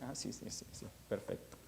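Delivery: whispered quick agreement; half-asleep, just breathe the words; sleepy whisper. Acted. Drowsy Samuel answering Elí in a whisper.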